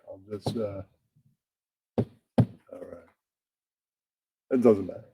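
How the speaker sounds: ordinary talk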